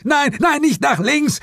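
very high, urgent yell; panicked scream. A narrator's reading. Partner warns detective who dives wrong way, screaming. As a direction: scream a last-second warning. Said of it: "schrie Suko".

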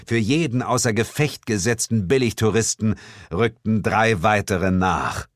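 ordinary talk

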